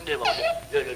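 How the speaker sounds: very high, crying whine; whining plea. Acted. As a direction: beg like he is about to cry. Begging, near tears. (रोती हुई ऊँची गिड़गिड़ाहट)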